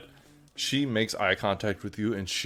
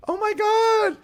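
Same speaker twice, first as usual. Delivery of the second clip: very high, loud delighted squeal; high-pitched excitement; 8 dB louder, slower than usual. Acted. Excited half-sister before a big hug, high squeal. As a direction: squeal in giddy agreement. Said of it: "in a very high-pitched voice".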